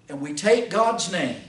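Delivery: ordinary talk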